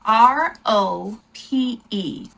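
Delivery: slow letter-by-letter spelling; teacher dictating letters one by one. Shown as a demonstration. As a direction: spell each letter slowly and clearly. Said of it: "if you wanna say Europe, that's".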